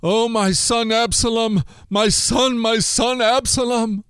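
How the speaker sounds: a father's anguished cry; crying out in grief. A narrator's reading. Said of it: "he cried".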